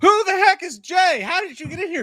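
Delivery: playfully appalled at an intruder; put-on high-pitched disbelief; mock outrage. Mock outrage at co-host's intro.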